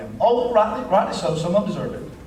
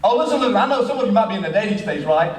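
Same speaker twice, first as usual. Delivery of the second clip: usual speaking voice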